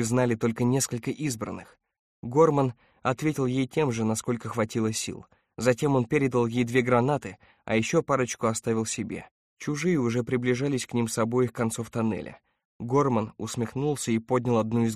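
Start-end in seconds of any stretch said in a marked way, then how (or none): none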